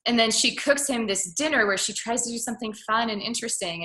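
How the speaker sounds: normal talking voice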